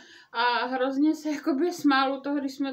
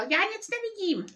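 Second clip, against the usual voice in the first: higher whining complaint; mock whine; 8 semitones higher than usual. Žbluňk dazzled on the veranda, higher mock whine. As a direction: whimper it like a dazzled child. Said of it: "zanaříkal".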